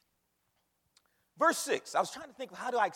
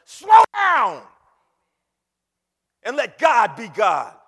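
commanding shout; 3 semitones higher, 18 dB louder, slower than usual. Real, not acted. Preacher telling busy believers to ease off, commanding shout. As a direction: stretch it out and roar it at the congregation.

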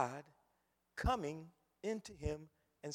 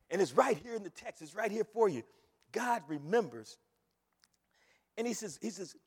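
ordinary talk, nothing marked

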